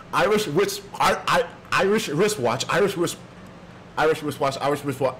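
fast tongue twister that keeps breaking down; hurried, tongue-tied attempts; racing and stumbling. Shown as a demonstration. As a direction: push through "Irish wristwatch", tripping and restarting. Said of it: "I gotta say it faster".

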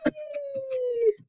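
high, drawn-out playful whee; gleeful whee. Acted. Teacher mixing paint in a bag for kids, gleeful whee. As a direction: squeal like a kid on a slide.

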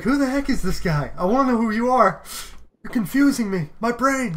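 playfully panicked and confused; mock panic.

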